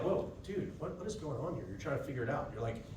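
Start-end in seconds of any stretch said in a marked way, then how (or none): 0.0-1.6 hushed, caught-off-guard whoa; a soft stunned reaction to a threat; baffled murmur. Retold or imitated. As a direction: mutter it like you've been blindsided.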